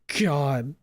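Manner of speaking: loud, high, rising panic; breaking into panic; panicked. A narrator's reading. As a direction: spiral into panic, then beg yourself calm. Panic attack building.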